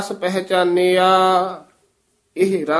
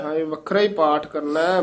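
ordinary talk, nothing marked

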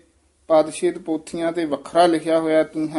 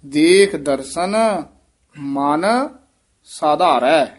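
long pauses either side of ਮਨ; word-group by word-group, ਮਨ standing alone; separated phrasing; 4 dB louder, much slower than usual. Shown as a demonstration. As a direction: set ਮਨ apart, then ਸਾਧਾਰੈ firmly. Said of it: "ਥੋੜ੍ਹਾ ਜਿਹਾ ਨਖੇਰ ਕੇ ਪੜ੍ਹਨਾ".

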